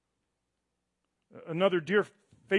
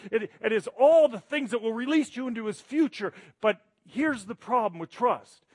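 higher and louder, driving; impassioned preaching; 8 semitones higher, 7 dB louder than usual. Acted. Pastor urges the congregation to trust God, passionately. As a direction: build the fire of a sermon.

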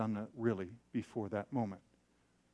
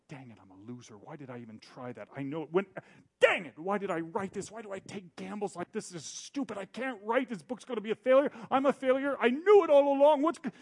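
spiraling self-talk; 7 semitones higher, 6 dB louder than usual. Retold or imitated. Alone in his office, spinning into panic over the critique. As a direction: panic building from a grumble to a wail.

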